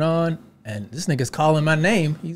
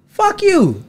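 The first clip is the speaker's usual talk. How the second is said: much higher, louder outburst; venting; 4 dB louder than usual. Real, not acted. Comedian venting at the crowd that hated him. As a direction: blurt it out in anger.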